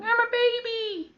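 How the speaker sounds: put-on very high, slow toddler voice; squeaky baby voice. Old guy telling the kids, very high slow squeak. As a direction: admit it like a tiny kid. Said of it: "And he's like".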